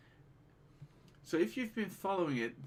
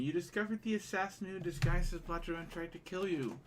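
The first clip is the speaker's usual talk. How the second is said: ordinary talk, nothing marked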